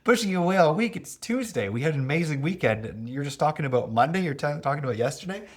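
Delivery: a laughing, incredulous reply to the partner; incredulous retort. Retold or imitated. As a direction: toss it back in amused disbelief. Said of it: "I was like".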